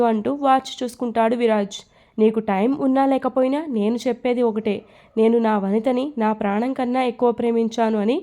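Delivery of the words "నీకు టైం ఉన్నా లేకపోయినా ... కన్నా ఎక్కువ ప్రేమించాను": ordinary talk, nothing marked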